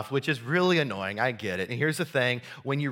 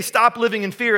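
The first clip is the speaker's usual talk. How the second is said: scolding slogan; 7 semitones higher, 6 dB louder than usual. Retold or imitated. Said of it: "He didn't say".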